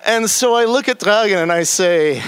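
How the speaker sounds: ordinary delivery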